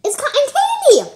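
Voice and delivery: loud, high excited shout. Real, not acted. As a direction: burst out with the answer. Excited guess in tasting game.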